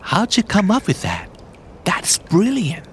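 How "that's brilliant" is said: awed whisper, no voice. Shown as a demonstration. Whispered repeat of phrase.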